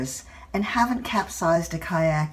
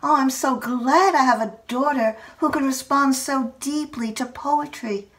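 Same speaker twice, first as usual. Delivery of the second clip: thrilled mother; 7 semitones higher than usual. Retold or imitated. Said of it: "My mother is thrilled".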